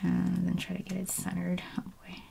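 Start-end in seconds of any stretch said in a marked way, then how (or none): none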